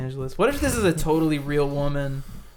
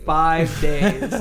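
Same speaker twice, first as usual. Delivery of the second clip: normal talking voice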